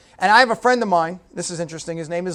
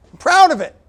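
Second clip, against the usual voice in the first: proud exclaim; 9 semitones higher, 7 dB louder than usual. Real, not acted. Proud of low score.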